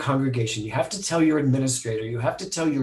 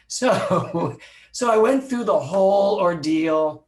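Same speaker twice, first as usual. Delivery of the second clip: laughing; 6 semitones higher, 6 dB louder, slower than usual. Real, not acted. Laughs recalling ordeal.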